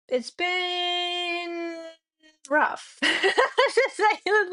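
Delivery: real rueful laugh through the words. Real, not acted. Streamer laughing off two rough weeks to chat. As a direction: laugh it off, voice lifting.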